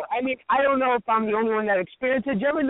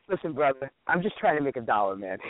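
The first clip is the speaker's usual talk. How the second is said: suddenly sane; 7 semitones lower, 3 dB quieter than usual. Retold or imitated. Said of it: "He gets sane".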